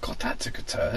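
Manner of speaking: under-breath whisper; whispered aside. Real, not acted. Aside after shocking headline.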